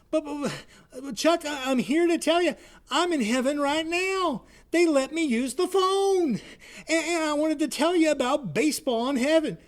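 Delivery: dead buddy calls his friend from heaven, voice pitched high; ghostly high friend on phone. Acted. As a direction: greet him high and bright like a ghost on the line. Bud explains from heaven.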